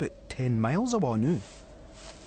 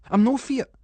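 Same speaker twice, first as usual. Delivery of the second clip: high, loud defiant shout; indignant boy shouting denial; 12 semitones higher, 7 dB louder than usual. Acted. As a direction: yell it to prove you're brave. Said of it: "shouted Callum".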